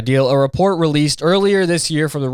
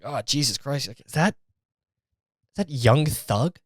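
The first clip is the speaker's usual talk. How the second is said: softer, slow disbelieving murmur; blinded by the light, murmur it slowly; squinting hangover; 6 dB quieter, slower than usual. Retold or imitated. Hungover inmate squints at the rapper, slow stunned murmur.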